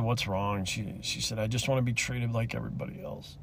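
real choked-up retelling, low and very soft; fighting tears. Real, not acted. Retelling the crying girl's plea, fighting tears. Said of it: "try to get this out without crying".

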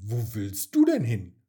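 loud, curious question; calling out. A narrator's reading. Mia asks the fleeing butterfly, calling out. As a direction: call the question after them. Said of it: "rief sie".

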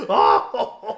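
normal talking voice

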